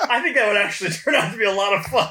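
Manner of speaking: amused, barely holding the laugh. Real, not acted. To cohosts about a repeat convention, laughing. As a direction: talk through a high laugh. Laughing at his own joke.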